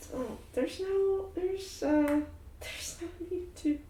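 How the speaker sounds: a hint of awkward soothing; halting comfort. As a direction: reach for words to calm her. Shar comforts sobbing Elektra.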